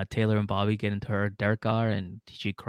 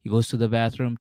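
ordinary talk

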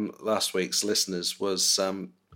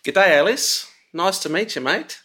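hammed-up Aussie accent, high and loud; a broad Australian "G'day" greeting, playing it up; mock Australian accent; 9 semitones higher, 6 dB louder than usual. Dad greeting Alice through an Aussie plug-in, Australian accent. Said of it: "a plug in that made me sound a bit more Australian".

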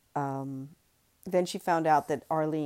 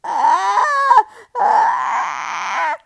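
put-on loud, high baby cry; baby wailing; 13 semitones higher, 15 dB louder than usual. Baby Angus wailing louder from hunger, loud. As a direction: wail like a hungry infant. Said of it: "Angus's cries grew louder".

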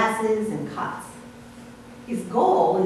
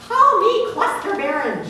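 high, louder cry of a frustrated branch; shouting branch; 10 semitones higher, 5 dB louder than usual. Acted. A branch shouts its frustration to Farmer Jones, high and loud. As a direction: blurt it out as a fed-up little branch. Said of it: "shouted another".

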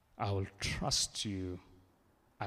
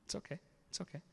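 higher, much softer comforting repeat; gentle reassurance; 7 semitones higher, 9 dB quieter, much faster than usual. Real, not acted. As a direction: soothe someone who is afraid. Comforting listeners at edge of change.

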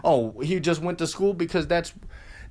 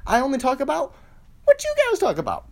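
rising rant; 7 semitones higher, faster than usual. Real, not acted. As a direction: build it up into a shout. Ranting about copying audience.

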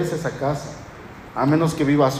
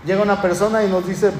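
usual speaking voice

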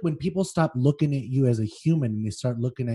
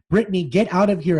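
urgent raised shout, higher and louder; 6 semitones higher, 7 dB louder, faster than usual. Acted. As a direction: call out a warning to her. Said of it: "I shouted to her".

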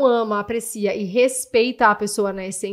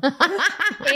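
bright laugh, pitched up; high burst of laughter; 7 semitones higher than usual. Real, not acted. Laughing at her own joke.

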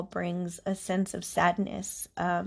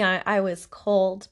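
ordinary talk

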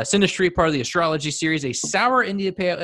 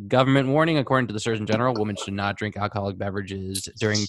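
fast; faster than usual. Acted. Rattling off government warning fast.

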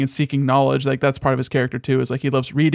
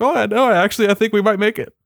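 mock cheery Tyrion; 7 semitones higher than usual. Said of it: "he actually laughs about it".

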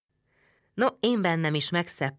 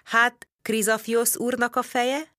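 slow, higher, probing question; interrogating tone; 8 semitones higher, slower than usual. A narrator's reading. Narrator questions the Greek servant in an interrogating tone. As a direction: press him for the answer, slowly. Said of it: "Vallató hangon folytattam".

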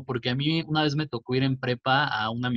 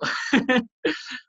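high burst of laughter; 11 semitones higher than usual. Real, not acted. Laughing at own story.

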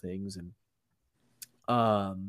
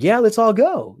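excited; 11 semitones higher, 11 dB louder than usual. Retold or imitated. Eager reply to a breakfast invite.

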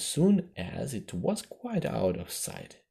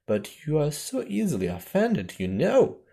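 higher, insistent plea; pleading; 5 semitones higher, 5 dB louder than usual. A narrator's reading. Alice to the offended mouse, pleading. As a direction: plead with a lifted voice. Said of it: "pleaded poor Alice".

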